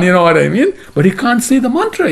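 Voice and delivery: normal talking voice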